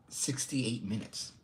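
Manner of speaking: normal talking voice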